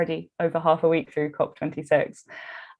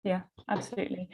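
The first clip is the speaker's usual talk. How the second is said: usual speaking voice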